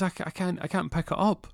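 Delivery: high put-on voice, stumbling on the start; straining complaint. Unable to lift the parcel, high struggling complaint. Acted struggle lifting heavy load.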